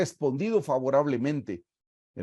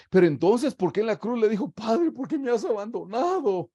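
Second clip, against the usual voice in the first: higher, questioning voice of a puzzled listener; objecting listener; 9 semitones higher than usual. Retold or imitated. Preacher voices a listener who quotes the cross, lightly higher and puzzled. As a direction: ask it like a doubter raising an objection. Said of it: "Pero usted me dice".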